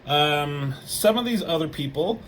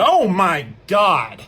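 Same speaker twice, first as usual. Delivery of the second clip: slow, loud, higher exclamation; big awestruck outburst; dramatic shock; 4 semitones higher, 8 dB louder, much slower than usual. Acted. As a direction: stretch it out in hammed-up amazement. Reacting to a comic's sudden attack.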